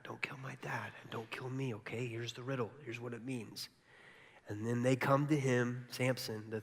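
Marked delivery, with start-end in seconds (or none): 0.0-3.7 very soft, half-whispered imitation; hushed secret. Bride to the guys with the riddle's answer, hushed. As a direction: pass on the secret under your breath.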